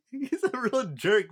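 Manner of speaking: high, slow, laughing it out; amused laugh calling him a jerk. Real, not acted. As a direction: chuckle through the words. Laughs while judging Poseidon.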